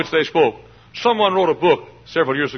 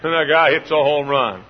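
ordinary talk, nothing marked

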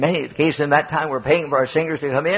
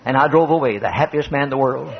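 ordinary delivery

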